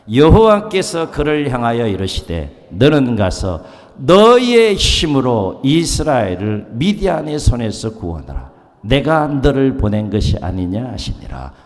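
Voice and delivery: slow, loud congregational reading; scripture read aloud. Shown as a demonstration. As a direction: read the verse slowly together. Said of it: "함께 읽습니다". (회중과 함께 천천히 읽는 성경 봉독)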